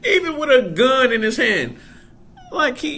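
high, loud laugh breaking into words; real laughter spilling into the line; laughing hard. Real, not acted. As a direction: laugh it out, near tears. Laughing at the scene.

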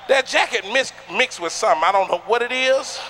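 ordinary delivery

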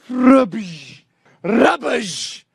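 high-pitched imitated impact crunch; loud crash noise. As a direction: imitate a car smashing into a deer. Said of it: "So fucking loud".

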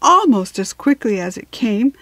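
ordinary delivery, nothing marked

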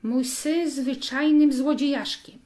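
loud, furious outburst at his thieving son; an enraged father shouting down his son; father explodes. A narrator's reading. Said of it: "ojciec wybuchnął".

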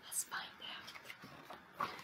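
hushed suspense whisper. Acted.

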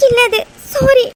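weepy, high apology; crying. Acted. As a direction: say sorry through sobs. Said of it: "இப்ப எதுக்கு நீ அலற?".

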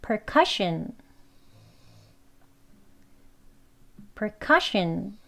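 percussion with stress on the second syllable. Shown as a demonstration. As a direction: say percussion, hitting cu. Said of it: "두 번째 신 앞에 커".

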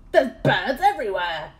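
loud, astonished cry; cried out. A narrator's reading. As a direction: shout at what the telescope shows. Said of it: "he cried".

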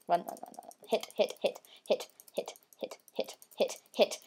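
whispered urgent chant; being chased. Real, not acted. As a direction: hiss it under breath. Fleeing, hitting an enemy.

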